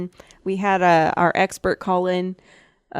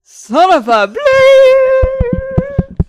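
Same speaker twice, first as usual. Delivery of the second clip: high, loud, drawn-out fake curse; mock outburst; 9 dB louder, much slower than usual. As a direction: stretch it into a self-censoring bleep. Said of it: "Just kidding".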